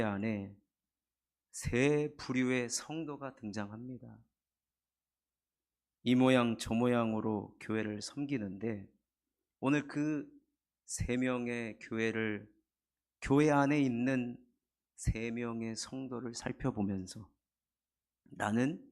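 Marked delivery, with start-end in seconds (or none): none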